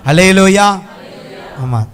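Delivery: usual speaking voice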